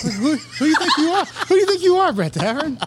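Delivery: high, loud mock outrage with laughter; mock indignation. Host ribbed about being on billboards, hammed-up loud indignation. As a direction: jokingly take him down a peg.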